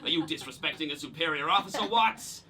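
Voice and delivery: indignant boss in a put-on American accent; ridiculous American accent. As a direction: play a military-style boss with a hammed-up American accent. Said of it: "Why are you doing that ridiculous accent?".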